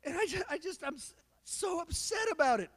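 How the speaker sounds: put-on high, teary little boy complaining to mom; crying son voice. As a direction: play the upset kid telling on dad. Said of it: "is just like".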